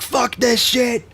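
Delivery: sudden high yelled outburst; hammed-up angry shout; mock rage shout. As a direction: blow up in fake fury. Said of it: "and then I'm like".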